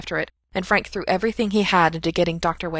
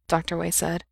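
usual speaking voice

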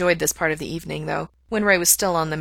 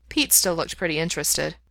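plain reading voice

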